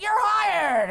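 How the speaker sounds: high, loud bellow; triumphant boss. Acted. Boss hiring Girardi on the spot, bellowing. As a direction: holler the decision with mock bluster.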